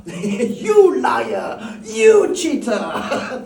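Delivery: loud, high accusing yell between laughs; mock gambler shouting. A card player hollering at a cheat, hammed-up. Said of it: "shouting at each other".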